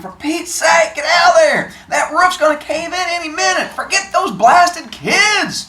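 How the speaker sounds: high, urgent yelling; screamed warning. A narrator's reading. Man screaming at a boy in a burning church, high yell. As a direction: scream it as an order to flee. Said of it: "he screamed".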